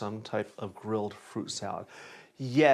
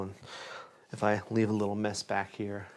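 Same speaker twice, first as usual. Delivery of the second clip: usual speaking voice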